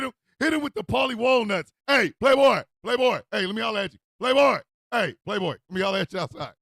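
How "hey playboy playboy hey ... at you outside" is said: loud, raised call-out; acted street holler. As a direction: shout for his attention in short, falling bursts. Acting out calling someone outside.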